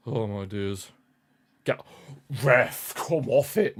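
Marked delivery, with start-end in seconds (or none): 1.6-3.8 loud, fed-up shout at the referee; angry yell at the ref. Real, not acted. Fan yelling at the referee over a bad call. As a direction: bark it at the ref like he's blind.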